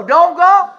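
loud, high, sharp command; urgent warning. Pretend. Holy Spirit warning the believer not to go, loud and high. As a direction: warn them like the Spirit pressing on you.

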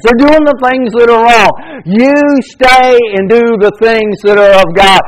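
high, loud, driving preacher's cry; full-force preaching. Acted. Preacher urging his church not to fear evil, full-force preaching. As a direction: fire up the congregation.

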